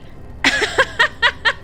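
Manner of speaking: high bubbling laugh. Real, not acted. Streamer amused by own commentary on Solas, laughing. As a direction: burst out laughing mid-sentence.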